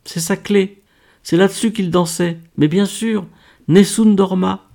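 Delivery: high, thrilled exclamations; high-pitched eureka excitement; excited realisation. A narrator's reading. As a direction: exclaim as it all falls into place. Laure excitedly recognises the piece.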